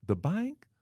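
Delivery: an overly friendly receptionist who is confused; Southern Miss Congeniality voice; sweet receptionist. Retold or imitated. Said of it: "Female, sweet, caring, and efficient, but overly friendly".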